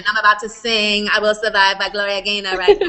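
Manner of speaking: laughing mock drag queen announcement; playful drag queen flourish, through laughter. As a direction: ham it up like a diva about to sing. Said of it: "sounded like a drag queen".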